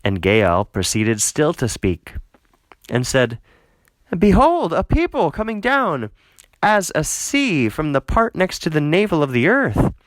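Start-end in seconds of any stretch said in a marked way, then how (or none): 4.2-9.9 much higher, startled call of warning; alarmed cry. Acted. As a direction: cry out in alarm at the approaching army. Gaal alarmed, sees army coming.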